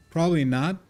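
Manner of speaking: loud, high mocking echo of his own words. Streamer repeating himself to chat, loud and teasing.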